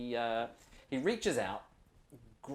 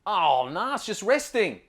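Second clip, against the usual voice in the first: higher, louder easygoing Aussie ranger giving a tour; 8 semitones higher, 9 dB louder than usual. Acted. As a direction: play the laid-back outback ranger showing the spot. GM answers players as ranger.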